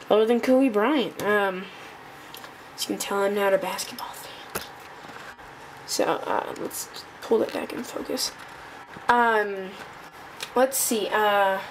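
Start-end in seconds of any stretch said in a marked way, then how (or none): 2.8-8.3 unvoiced whispered aside; whispering. Real, not acted. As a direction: whisper it under your breath. Aside while phone listens.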